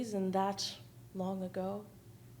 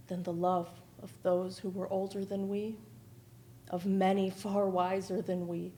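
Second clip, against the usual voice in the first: usual speaking voice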